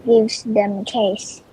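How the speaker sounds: usual speaking voice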